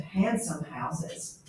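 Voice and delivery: ordinary talk, nothing marked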